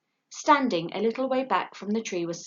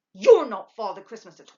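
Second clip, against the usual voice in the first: louder, indignant owlet; disappointed owlet telling off the lady, cross; 3 semitones higher, 12 dB louder than usual. A narrator's reading. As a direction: sulk and accuse. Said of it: "he said crossly".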